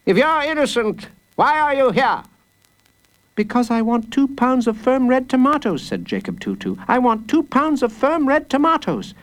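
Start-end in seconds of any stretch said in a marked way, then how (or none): 0.1-2.2 high, accusing judge; glaring judge. A narrator's reading. Judge glaring at the boy, accusing. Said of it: "He glared at Jacob Tutu". 3.3-5.8 put-on high, earnest child's voice; little boy. Small boy explaining himself to the judge, earnest. 6.9-9.1 put-on high, earnest child's voice; little boy. Small boy explaining himself to the judge, earnest.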